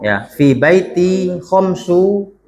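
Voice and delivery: khomsu drawn out, slow and clear; slow model sentence. Shown as a demonstration. Teacher models the sentence for students, slow and deliberate. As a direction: spell it out slowly for the class. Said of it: "kita sebutkan dulu".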